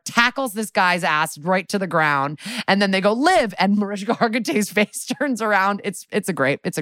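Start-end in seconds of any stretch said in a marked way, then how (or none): 3.1-3.5 mock call out. Officers call out Liv.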